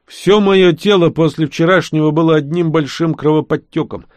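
ordinary delivery

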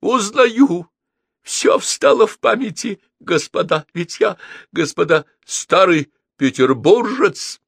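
high, loud joyful exclamation; an old diplomat overcome with memories; exclaiming. A narrator's reading. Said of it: "воскликнул".

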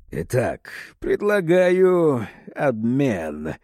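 slow creaky villain voice. A narrator's reading. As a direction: creak it out slowly. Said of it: "скрипучим голосом сказал Калошин".

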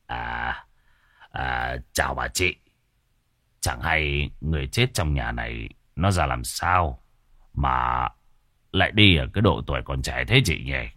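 butter her up quietly; ingratiating greeting. A narrator's reading. Sorcerer sidling up to a gossiping woman, soft oily greeting.

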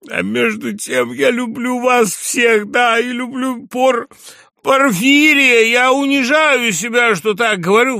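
put-on high, loud, overwrought drunken speech; distraught loud plea. A narrator's reading. Drunk general protests to his guests that he loves them, distraught loud plea. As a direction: declaim high and loud, slowing on key words.

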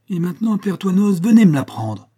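high, loud dare; defiant challenge. A narrator's reading. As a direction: challenge him at full voice. Jacques defies his father-in-law. (défi lancé haut et fort)

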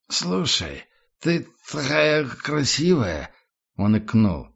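put-on slow, high, slurred drunk praise; drunken admiration. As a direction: gush like a tipsy tramp. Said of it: "тоном пьяного восхищения".

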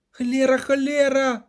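high-pitched, agitated curse; crying out. A narrator's reading. Bard cursing amid the wreckage, crying out. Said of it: "скрикнув Любисток".

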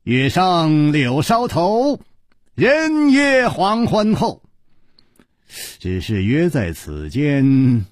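drawn-out verse, pitched high; slow, amused. A narrator's reading. Chu Liuxiang quoting a lovers' poem while pacing, slow and amused. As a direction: stretch each phrase out teasingly, as if reciting. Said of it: "慢声笑道".

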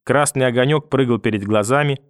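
ordinary talk, nothing marked

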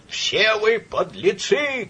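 put-on high, long-drawn drunken yell; stretched-out shout of abuse; angry shout. A narrator's reading. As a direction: bellow the insult slowly at everyone. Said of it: "кричал сотрудник".

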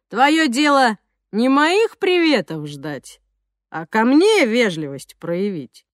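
slow, sneering street thug; menacing thug voice. Acted. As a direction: drawl it like a yard bully picking a fight. Thug Zhokh taunting.

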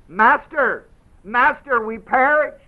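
high, loud, panicked pleading; frantic cry for help. Retold or imitated. Disciples wake their master in the storm, panicked loud cry. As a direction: cry out like men sinking in a storm. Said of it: "they came to him and awoke him, saying".

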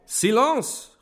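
high, loud cry for silence; shrieked-up call to order. A narrator's reading. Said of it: "rief Ferfitschkin".

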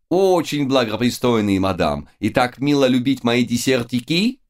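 put-on lightly French-accented, cheery; jolly French baker. A narrator's reading. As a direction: gush like a happy French baker. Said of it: "жизнерадостный Луи".

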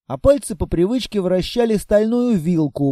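usual speaking voice

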